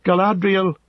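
high, loud invocation of a name; ringing high, loud call. A narrator's reading. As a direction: call the name out like a battle cry. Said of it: "he called".

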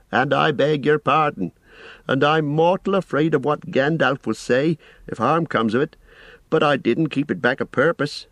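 a busy, apologetic innkeeper rambling on; fussy landlord. A narrator's reading. Landlord Butterbur voiced.

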